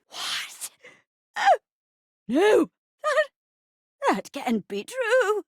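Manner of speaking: put-on halting, shocked old-lady melodrama; flustered old granny. Old wife rebuffs the detective, flustered granny voice. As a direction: stammer and gasp like a scandalised old wife. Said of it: "I'll be playing the part of the poor old rocking chair".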